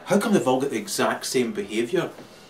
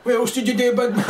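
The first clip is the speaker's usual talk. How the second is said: high, louder mock taunt through laughter; mocking tough-guy taunt; 11 semitones higher, 6 dB louder, faster than usual. Policewoman taunting a suspect, mock sneering taunt. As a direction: sneer it like a cop teasing a hard man.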